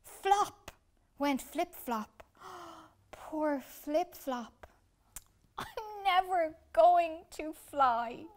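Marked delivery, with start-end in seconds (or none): 0.0-0.7 higher, louder Flop; comic falling flop; thud word. A narrator's reading. Falls to ground. 3.1-8.2 slow, higher, louder wail; mournful slow wail; sobbing wail, much higher; crying. Acted. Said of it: "he cried".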